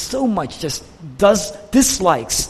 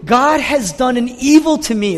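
raised, higher voice of grievance; exceedingly angry; 6 semitones higher, 4 dB louder than usual. Retold or imitated. Preacher as Jonah aggrieved at God, angry. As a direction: retell it as an aggrieved prophet. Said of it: "he's exceedingly angry".

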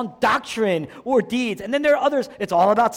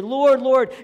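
raised, loud, heartfelt call; emotional appeal; 5 semitones higher, 6 dB louder than usual. Retold or imitated. Quoting the doublet from Hebrew literature, emotional. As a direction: cry it out with emotion. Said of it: "it represents emotional content".

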